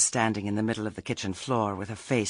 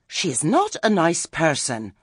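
high, loud trill of disapproval; 8 semitones higher, 6 dB louder than usual. A narrator's reading. Lettice complaining about Granny to Nanny, trilling. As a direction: trill it like a hunting bird. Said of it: "Lettice trilled".

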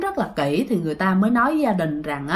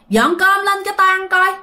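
put-on much higher, bossy old-man order; 9 semitones higher than usual. Grandpa ordering daughter-in-law to bring rice, bossy. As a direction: command the daughter-in-law to serve food. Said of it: "ổng nói rằng á là".

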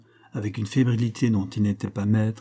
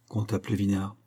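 ordinary delivery, nothing marked

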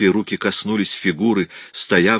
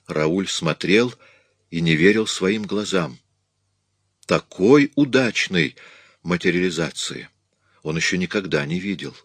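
ordinary delivery

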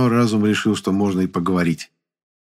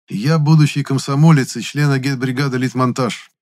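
put-on louder, higher, self-important teenage declaration; pompous young activist introducing himself; 5 semitones higher, 4 dB louder than usual. A narrator's reading. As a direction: announce it with great importance. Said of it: "С важным видом".

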